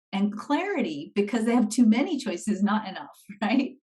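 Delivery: ordinary talk, nothing marked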